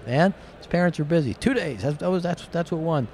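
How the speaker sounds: high, excited arguing; excited retelling. Retold or imitated. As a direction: pitch up and argue it's fine. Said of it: "and I'm like".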